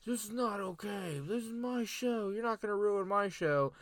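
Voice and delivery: high, strained mock rant; imitating a furious screaming host; Miz screaming. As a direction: channel an outraged host losing it on his own show. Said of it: "he just started screaming".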